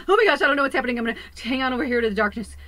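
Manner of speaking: high, loud, fast imitation of panicking people; hammed-up panic, clinging to the dark; mock panic. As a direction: play the frightened crowd, half-mocking. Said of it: "People are like".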